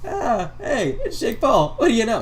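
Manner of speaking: high, light mock greeting; Cody Ko. YouTuber imitating Cody greeting Jake Paul, high mock-friendly. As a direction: imitate a breezy, high-pitched hello. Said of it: "Cody Ko is just like".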